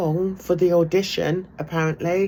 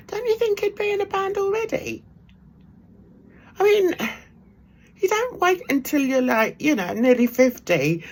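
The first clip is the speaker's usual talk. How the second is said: incredulous; 12 semitones higher than usual. Real, not acted. Incredulous rhetorical question.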